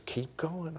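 high, very soft tempter's urging; Satan whispering. Acted. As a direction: egg them on in a hush, as Satan. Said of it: "Satan keeps whispering".

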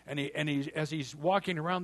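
ordinary talk

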